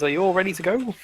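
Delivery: a hint of a young mage asking. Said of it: "a young-looking mage there who says".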